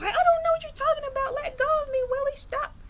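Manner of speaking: very high, rushed squeal of protest; alarmed protest. Retold or imitated. Sister grabbed by Willie, high-pitched alarmed protest. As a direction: imitate a woman pleading to be let go, voice shot way up.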